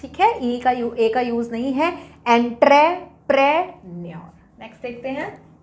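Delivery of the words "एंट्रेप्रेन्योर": "Entrepreneur" in slow, loud separate chunks; slow, emphatic breakdown of "entrepreneur"; syllable by syllable. Shown as a demonstration. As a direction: punch out each part of "entrepreneur", pausing between. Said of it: "अब ऐसे याद करते हैं".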